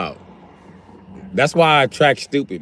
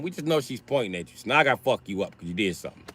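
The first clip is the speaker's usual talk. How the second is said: ordinary talk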